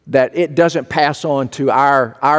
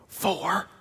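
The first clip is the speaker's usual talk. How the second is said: put-on much softer, small-boy voice; hushed little boy; 4 semitones higher, 13 dB quieter than usual. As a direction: answer like a shy four-year-old on the phone.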